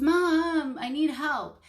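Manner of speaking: mock a kid yelling for Mom; imitating a frustrated daughter demanding help; demanding whiny tone. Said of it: "that's not the tone that I modeled".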